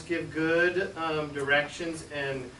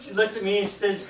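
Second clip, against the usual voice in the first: ordinary delivery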